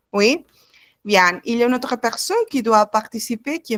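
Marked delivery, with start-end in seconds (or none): none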